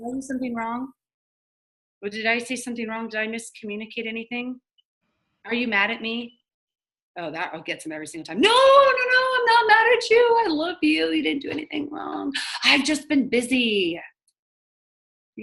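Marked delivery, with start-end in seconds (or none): 0.1-6.4 small, soft, slightly raised voice; gentle. Acted. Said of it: "gotta do it gently". 8.4-14.0 very high, put-on voice of an over-apologetic prospect; gushing reassurance. Acting the prospect's gushing denial.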